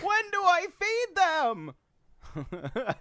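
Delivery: very high, very slow and drawn out; high stretched mock voice. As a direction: stretch it way up in a silly squeal. Mock repeat of own question.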